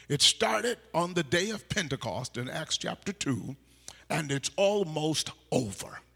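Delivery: loud, emphatic scripture preaching; preaching at full force. Acted. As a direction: thunder the verse from the pulpit.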